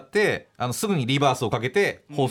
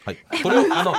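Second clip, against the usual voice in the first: ordinary talk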